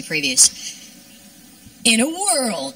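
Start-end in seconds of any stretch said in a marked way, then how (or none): 1.8-2.7 trailer voice. Pretend. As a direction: intone it like a film preview. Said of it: "my impression of every movie trailer".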